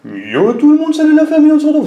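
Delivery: big bright channel hello; hyped youtuber intro. Shown as a demonstration. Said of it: "les youtubeurs qui font des vidéos qui commencent comme ça".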